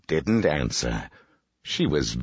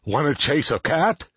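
high-pitched dog character voice; barking dog; 10 semitones higher than usual. A narrator's reading. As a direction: ask as an eager storybook dog. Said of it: "barked the dog".

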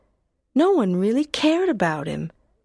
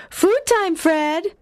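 high sing-song call to a dog; 9 semitones higher, slower than usual. Acted. Owner calling her dog Fred to his dish, high sing-song call. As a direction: call him over brightly, pitched up.

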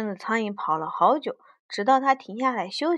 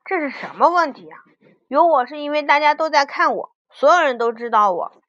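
loud, huffy television character; displeased; 4 semitones higher, 8 dB louder than usual. A narrator's reading. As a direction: answer indignantly, louder and insistent. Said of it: "电视机不高兴地回答".

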